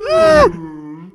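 high, loud, slow whale call. Pretend. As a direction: stretch it into a whale's cry. Imagining a whale's voice.

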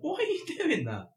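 high incredulous question; bewildered cry. Pretend. Host mocking the singing housemate, high and baffled. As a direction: act baffled at him.

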